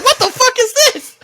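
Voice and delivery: high squeaky laughing what the; laughing disbelief. Retold or imitated. Kid gets a Raising Cane's book, laughing disbelief. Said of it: "You're like".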